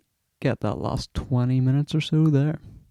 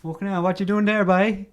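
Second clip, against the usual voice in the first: put-on Cork accent; 8 semitones higher than usual. Quoting Young Offenders characters.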